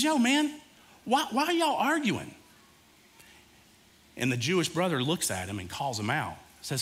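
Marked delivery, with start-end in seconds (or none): 0.0-2.3 high, relaxed street-slang Moses; casual street talk. Retold or imitated. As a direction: toss it off like a buddy stepping into a fight. Moses breaks up brothers' quarrel.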